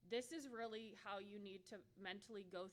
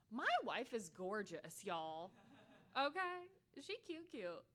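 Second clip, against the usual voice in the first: sassy correction; 6 dB louder, slower than usual. Retold or imitated. Correcting coworkers who assume a husband, sassy and loud. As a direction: set them straight with attitude.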